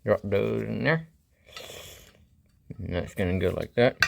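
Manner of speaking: talking around a flashlight held in teeth; muffled words past a flashlight; flashlight in mouth. Real, not acted. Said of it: "I'm gonna put a flashlight in my mouth".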